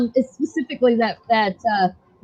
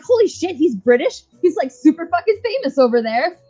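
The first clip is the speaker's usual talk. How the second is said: retold shock; 7 semitones higher than usual. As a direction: blurt it out like the news just landed. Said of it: "I was like".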